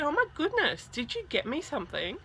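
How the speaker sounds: high-pitched excited asking; delighted surprise. Retold or imitated. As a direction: imitate a star thrilled at a possible gift. Idol's delighted question, retold.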